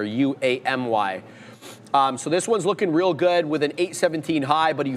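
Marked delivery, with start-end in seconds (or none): 0.0-1.2 "U A M Y" letter by letter, unhurried; spelled out slowly. Shown as a demonstration. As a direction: spell "U A M Y" clearly for viewers. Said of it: "that's the ticker".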